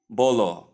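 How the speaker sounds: "bolo" for bowl, two o syllables; mispronounced bowl. Shown as a demonstration. Teacher showing what not to say, bowl mispronounced "bolo". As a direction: add an o to the end, "bolo".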